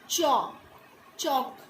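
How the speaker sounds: चौक said with the open vowel between aa and o; open au sound. Shown as a demonstration. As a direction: say चौक with an open, rounded au, not o. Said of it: "चौक नहीं बोल रहे हम".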